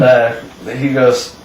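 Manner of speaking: ordinary talk, nothing marked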